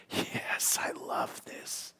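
tender whispered delight. Acted. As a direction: breathe it, no voice at all. God voiced in a whisper.

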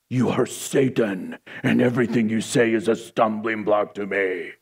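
dramatic voice of divine rebuke; harsh rebuke. Acted. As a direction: intone the condemnation low and heavy. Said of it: "can't even handle gentle disagreements".

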